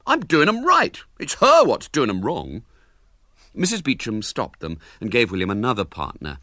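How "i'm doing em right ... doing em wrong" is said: put-on louder, high boyish retort; spirited defence. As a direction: fire back like a cheeky schoolboy. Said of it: "defended himself with spirit".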